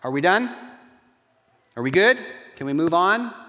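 put-on high, loud kid angling to be let off; kid's hasty apology. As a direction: play a caught kid tossing off a sorry. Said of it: "Kid goes".

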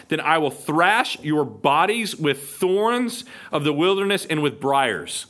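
thrash your bodies with thorns, dragged out slow; slow threat. Acted. Gideon warns the officials of Sukkoth: slow, deliberate. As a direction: lean on each word of the threat, slowly.

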